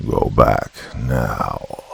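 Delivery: low, very slow demonic command; bitter demonic tone. A narrator's reading. The demon inside orders the fleeing girl back, low and slow. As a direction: drag each word out, low and bitter like a demon. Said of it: "Now it was a bitter, demonic tone".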